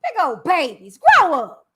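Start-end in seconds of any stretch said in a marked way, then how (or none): 1.0-1.7 loud scold. Real, not acted. Scolding again.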